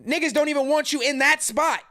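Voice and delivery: ordinary talk, nothing marked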